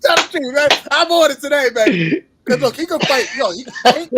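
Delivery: loud, high-pitched excited hollering; hyped up. Real, not acted. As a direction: holler it out, riding a laugh. Hyped, egging on co-host after laughing.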